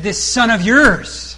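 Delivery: "This son of yours" drawn out slowly, leaning on "yours"; stress on yours. Shown as a demonstration. Preacher pointing out the brother's coldness, slow stress on "yours". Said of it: "Notice he said".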